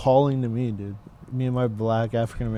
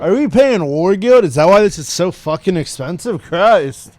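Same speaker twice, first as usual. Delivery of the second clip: loud, raised pitch, acted indignation; outraged customer; 5 semitones higher, 10 dB louder than usual. Acting a driver fuming over gas prices, outraged. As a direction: blurt it out in exasperated outrage.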